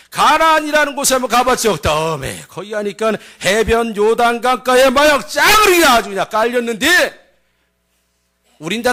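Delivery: alarmed Chungcheong storyteller; Chungcheong dialect. Acted. Spy warns villagers they are doomed, Chungcheong accent. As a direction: pitch up the doom in Chungcheong speech. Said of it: "충청도 버전으로".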